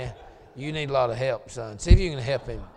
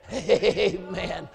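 laughing amen; 2 semitones higher than usual. Real, not acted. Laughing after reading verse.

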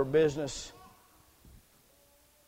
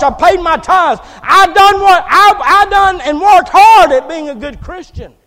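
high, full-throated pleading shout; frantic church member shouting self-justification; desperate protest at judgment; 17 dB louder than usual. Acted. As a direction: wail your case at the top of your voice.

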